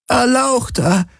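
high creature voice calling out; Kesli calling. A narrator's reading. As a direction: village elder calls out to him. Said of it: "hörte er Kesli rufen".

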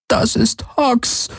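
mother names her wounded son in tears; tearful mother. A narrator's reading. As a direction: choke the name out slowly. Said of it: "Tränen liefen in ihren Pelz".

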